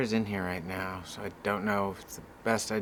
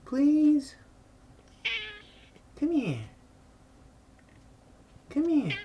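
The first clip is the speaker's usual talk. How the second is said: high pleading coax; coaxing a pet; slower than usual. Real, not acted. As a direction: sweetly beg her to come out. Coaxing hiding cat out.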